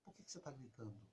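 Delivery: soft and low, calmly asking; quiet reply. Retold or imitated. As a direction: answer the shouting very softly. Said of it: "Lá em casa eu fazia assim". (pergunta baixinha, calma)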